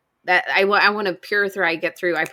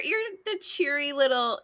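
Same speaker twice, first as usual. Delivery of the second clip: ordinary talk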